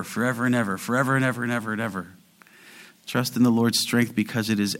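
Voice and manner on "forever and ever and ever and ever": rapid piling repetition. Shown as a demonstration. Building superlative emphasis.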